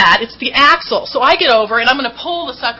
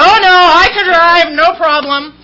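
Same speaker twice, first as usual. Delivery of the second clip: mock drunk bravado; 5 semitones higher, 7 dB louder, slower than usual. Drunk self refusing to hand keys.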